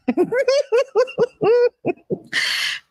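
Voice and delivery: laughing helplessly at the memory; high laughing burst. Real, not acted.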